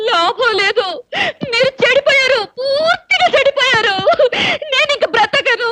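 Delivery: high, hammed-up crying; tearful wailing. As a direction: wail it out in despair, drawing out the ends. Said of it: "నువ్వు మరీ అలా ఏడుస్తుంటే".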